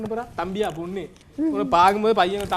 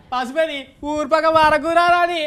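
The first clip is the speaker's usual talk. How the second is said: village headman voice; 6 semitones higher, 5 dB louder, slower than usual. Acted. Nattamai banishing brother Pasupathi.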